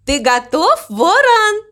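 high, eager shouted question; calling out. A narrator's reading. Mama Mu checks the crow is ready, calling out high. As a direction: call up high to a friend. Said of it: "крикнула мама Му".

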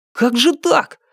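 put-on high-pitched baffled teenager; high, puzzled youngster; surprised. A narrator's reading. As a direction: ask in disbelief, voice way up. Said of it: "удивился подросток".

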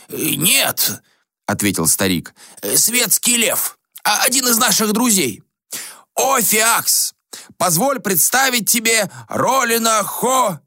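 a lightly voiced formal old sage; old ascetic making an introduction. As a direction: announce the guest grandly until you're cut off. Gomon voice.

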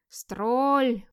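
loud, drawn-out bellow; long eerie beast cry; monster's roar. Acted. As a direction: roar it suddenly, stretching the vowel. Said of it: "взревело вдруг совсем рядом".

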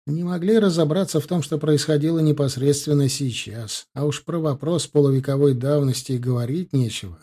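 ordinary delivery, nothing marked

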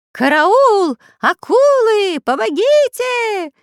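high, loud, drawn-out cries for help; panicked shouting. A narrator's reading. Robbers floundering in the water, crying for help. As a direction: yell for help as if drowning. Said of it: "кричали разбойники".

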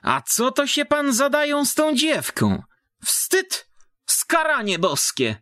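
scolding village woman. Acted. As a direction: scold him like a pious peasant matron. (wysoki, karcący głos wiejskiej baby)

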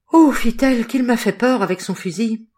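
loud, high outburst after a scare; frightened relief. A narrator's reading. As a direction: let the fright burst out, then ease down. Fright voiced after a gunshot.

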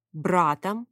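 ordinary delivery, nothing marked

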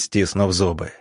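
ordinary delivery

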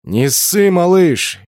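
merry, teasing holler; cheerful shout. A narrator's reading. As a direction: cheer on a nervous youngster. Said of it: "весело крикнул мне".

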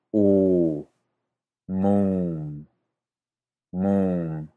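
U and mum with a long rounded u; Icelandic ú. Shown as a demonstration. Teacher models Icelandic mum with a long u. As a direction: stretch the u in mum. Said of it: "se pronuncia".

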